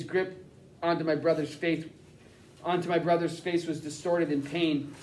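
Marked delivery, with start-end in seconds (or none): none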